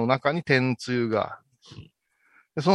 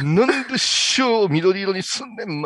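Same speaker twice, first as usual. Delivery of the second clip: put-on raised, indignant old monk; mock angry rant; 7 semitones higher, 3 dB louder than usual. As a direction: play his furious outburst. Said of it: "すごい剣幕で怒るんですよ".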